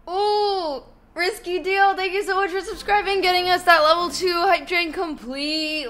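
high, loud, fast hype; excited. Real, not acted. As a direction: cheer the subscriber quickly. Hype train alert.

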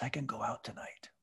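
hushed, breathy inner scheming; whispered thought. Retold or imitated. Man in church plotting to himself, in a whisper. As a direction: whisper it to yourself like a secret plan.

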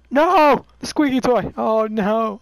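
loud high no of dismay; dismayed cry. Real, not acted. Breaks the toy.

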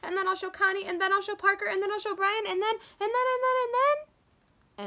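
put-on high, eager child listing everyone; bubbling over, she can't wait to tell; excited chatter. Little girl telling her daddy every plan, eagerly.

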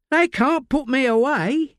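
high, coarse London voice of a desperate addict; Layla, rough addict. Acted. Addict Layla snapping at detective Josie in interview. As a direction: snap like a strung-out woman in custody.

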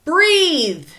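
long, loud, high yell; group shout. A narrator's reading. Whole class urging Stephen on, loud high shout. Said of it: "heard the entire class shout together".

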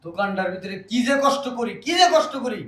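high-pitched, exasperated lament; aggrieved complaint. Acted. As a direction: let the grievance climb in pitch. Brother complains of his hard toil.

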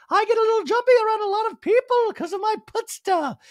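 very high, loud mock voice; hammed-up jumpy impression; Dorit. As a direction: squeal it in a put-on voice. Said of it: "And Dorit's like".

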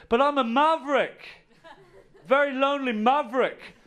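high, loud self-mocking boast; mock proud protest. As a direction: ham up the lonely maverick. Acting out protest to guide.

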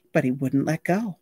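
ordinary delivery, nothing marked

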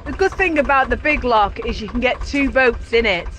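ordinary delivery

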